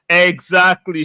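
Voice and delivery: loud, high burst of agreement; bursting in to agree; excited agreement. Real, not acted. Agreeing with a viewer.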